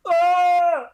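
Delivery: high wordless wail; high-pitched mock cry; mock shouted answer. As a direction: imitate her hollering out unintelligibly. Imitating woman's shouted answer.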